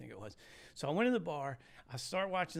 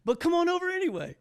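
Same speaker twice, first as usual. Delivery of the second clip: much higher, louder, laughing through it; kid's invite; 11 dB louder than usual. Retold or imitated. Twelve-year-old inviting the stranger over, high and laughing. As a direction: squeak it out like a cheerful kid.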